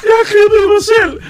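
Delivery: very high, loud wail; hammed-up shrieking confession; panicked retelling. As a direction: squeal it in mock panic. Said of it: "jeg gik jo i panik".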